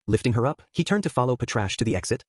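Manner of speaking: ordinary delivery, nothing marked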